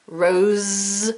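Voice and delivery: ROSE drawn out with a humming Z ending; buzzing Z. Shown as a demonstration. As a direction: hold the voiced Z at the end of "rose". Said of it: "you can feel the Z sound".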